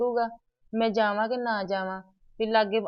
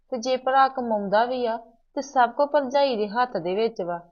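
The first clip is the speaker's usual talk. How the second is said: ordinary talk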